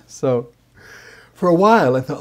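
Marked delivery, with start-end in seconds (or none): none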